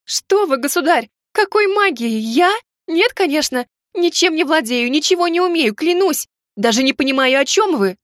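high, panicked protesting; frightened denial. Acted. Frightened heroine denies magic.

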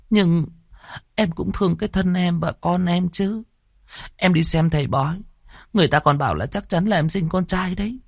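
put-on high, pleading girl's voice; tearful young woman. A narrator's reading. Pregnant maid tearfully pleading with the landlord. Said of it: "cô Sen lại khóc lóc".

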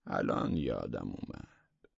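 slow, low "now I remember"; settled recollection. A narrator's reading. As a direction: let it sink down slowly as it comes back. (یادآوری آهسته با صدای بم)

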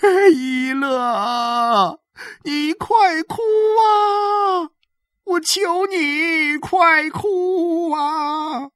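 high, wailing shout, very drawn out. A narrator's reading. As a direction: beg loudly through tears, stretching every word. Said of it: "然后他又对着伊勒喊道".

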